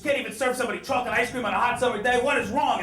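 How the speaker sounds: hammed-up meltdown over ice cream; losing it. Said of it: "this guy just loses it".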